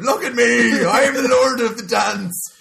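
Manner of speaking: high, booming boast with drawn-out words; hammed-up show-off bellowing to the house; mock grandiose Flatley. Michael Flatley parading round his house, mock grandiose shout.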